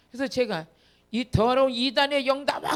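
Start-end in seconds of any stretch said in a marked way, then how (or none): 1.1-2.8 loud, raised command to cast out; exorcism command. Retold or imitated. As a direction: order the evil spirit out forcefully. Retold exorcism command to spirit.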